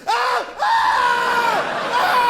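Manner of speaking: very high screams of terror; death screams. Acted. Animal's life ending by being eaten, terrified screams. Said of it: "Every life ends like this".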